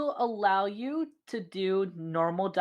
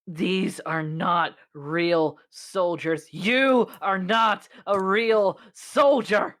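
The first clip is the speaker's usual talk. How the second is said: very slow, deliberate villain voice building louder; snarling menace; 5 dB louder, much slower than usual. Acted. Crimson Curse confronting Siobhan as a false soldier, snarling menace. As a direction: spit out each word slowly, swelling into the accusation. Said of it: "snarling look on their face".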